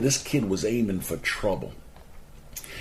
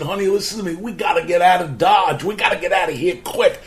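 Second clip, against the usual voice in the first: raised, higher voice pressing his wife; urgent plea; 8 semitones higher, 5 dB louder than usual. Retold or imitated. Husband begging his wife to leave the new house, urgent raised voice. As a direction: urge her, louder and higher, no time to lose.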